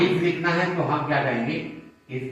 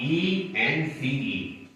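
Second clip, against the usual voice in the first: letters spelled one by one, very slow; teacher spells each letter out slowly; spelling it out slowly; 4 dB quieter, much slower than usual. Shown as a demonstration. Teacher spelling ence for students, slow and deliberate. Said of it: "अगर एंस की आवाज".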